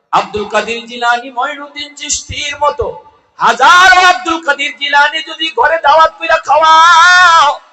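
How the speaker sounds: high, loud chanting sermon delivery; ringing, high-pitched lament to a crowd; impassioned preaching. Real, not acted. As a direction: preach at full force, pitched far up. Preaches at full force.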